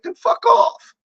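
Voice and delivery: jumps way up into a mocking squeal; squeaky fuck off. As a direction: flip the voice high to mock haters. Dismissive outburst at viewers.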